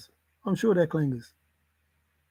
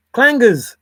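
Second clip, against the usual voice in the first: excited shout; 7 semitones higher, 10 dB louder than usual. Real, not acted. Excited recognition of the clangers.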